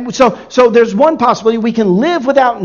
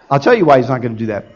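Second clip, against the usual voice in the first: usual speaking voice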